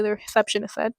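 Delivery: ordinary delivery, nothing marked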